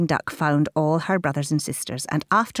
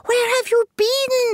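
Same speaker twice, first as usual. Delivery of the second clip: high, slow, louder shriek; 4 dB louder, slower than usual. A narrator's reading. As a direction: shriek at the returning ducklings. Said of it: "shrieked Mummy Duck".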